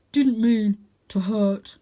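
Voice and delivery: slow, heavy simple-minded dog; big dog voice. A narrator's reading. Big dog apologising to the cat, slow and heavy. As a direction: lumber through it like a gentle giant.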